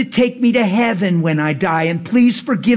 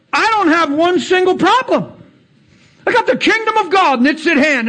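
fervent preaching; 8 semitones higher than usual. Acted. Preacher telling the congregation about God's kingdom, fervent. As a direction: proclaim it like revival preaching.

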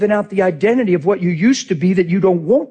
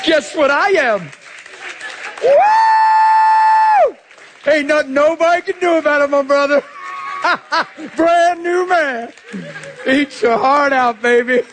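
high, whooping celebration with laughs; gleeful crowing; 10 semitones higher than usual. Real, not acted. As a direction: gloat joyfully, pitched way up. Crows over being made new.

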